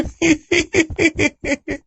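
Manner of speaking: high deranged laugh; long high-pitched crazed laughter; mad cackle. A narrator's reading. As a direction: cackle like the mad girl running off. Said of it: "पगली वहां से भाग तो गई".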